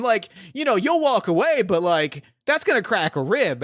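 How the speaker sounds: hammed-up gung-ho general, rising as he brags; George C. Scott. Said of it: "full George C. Scott in, uh, Doctor Strangelove".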